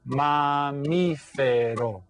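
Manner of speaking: "Mamífero" very slow, four drawn-out beats; syllable by syllable. Shown as a demonstration. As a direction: clap out "Mamífero" as ma-MEE-fe-ro. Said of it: "How many syllables?".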